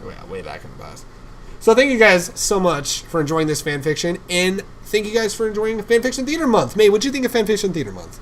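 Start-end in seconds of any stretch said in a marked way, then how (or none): 1.6-8.2 high, loud host outro; excited sign-off. Real, not acted. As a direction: thank the audience with big, loud energy. Host's outro thanks.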